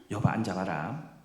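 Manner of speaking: low, soft, patient explanation; sit them down and explain gently; gentle explaining God. Pretend. God imagined calmly explaining pain to Job, low and soft. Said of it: "이렇게 말씀 안 하세요".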